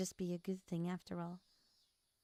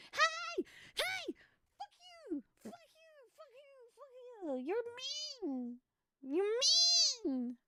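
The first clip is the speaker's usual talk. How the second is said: high shrill-free squeaky mock yelling; playful fake anger, much higher; mock outrage; slower than usual. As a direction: pretend to be offended.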